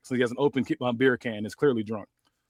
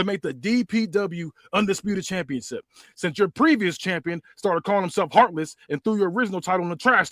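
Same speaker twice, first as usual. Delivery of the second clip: Rock promo; 5 semitones higher than usual. Acted. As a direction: cut a promo as The Rock, hamming it up.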